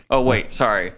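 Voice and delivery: usual speaking voice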